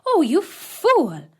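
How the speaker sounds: high, slow scornful wife's cry; wife scorning husband, high and slow; scornful stepmother. Acted. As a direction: sneer it high and drawn out.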